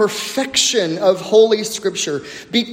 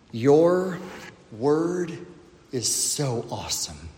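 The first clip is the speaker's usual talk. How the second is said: hushed awe; 6 semitones lower, 8 dB quieter than usual. Real, not acted. Hushed awe after pause.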